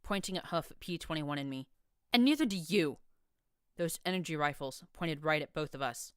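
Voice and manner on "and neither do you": 1 contemptuous shout. A narrator's reading. Dusk continues shouting.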